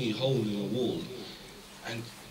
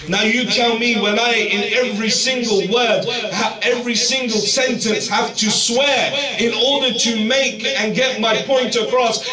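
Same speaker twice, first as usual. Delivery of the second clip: much higher and louder, driving each phrase home; fiery preaching; 9 semitones higher, 10 dB louder than usual. Acted. Preacher warning the youth about swearing, fiery sermon. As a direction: preach it out at full force to the crowd.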